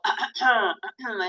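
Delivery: demonstrative throat clear; deliberate "ahem" as an example. Shown as a demonstration. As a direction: clear your throat pointedly. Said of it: "se llama rasquidos".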